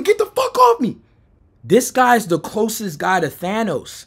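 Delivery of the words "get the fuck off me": very high, strained cry of protest; mock scream. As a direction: imitate screaming without full volume. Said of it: "I'm screaming".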